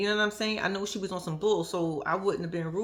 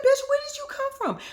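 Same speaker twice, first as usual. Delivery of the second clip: high shrieking disbelief; shocked laughing; 4 dB louder than usual. Real, not acted. Vlogger shocked Donna appeared, high laughing shriek.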